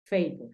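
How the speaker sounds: favor as English FEY-vor, fey glide then plain or; teacher modeling favor in English. Shown as a demonstration. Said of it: "Entonces tú dices favor".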